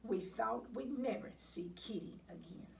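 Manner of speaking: ordinary delivery, nothing marked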